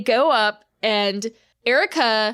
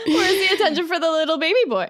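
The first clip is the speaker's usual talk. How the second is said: put-on high, fast baby-talk whine; whiny baby voice; 7 semitones higher, faster than usual. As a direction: whine like a pouty toddler. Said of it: "Oh, no".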